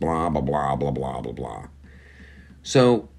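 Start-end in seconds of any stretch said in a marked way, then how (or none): 0.0-1.7 dismissive blah. Real, not acted. Dismissive fading.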